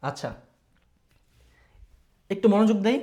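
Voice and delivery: ordinary delivery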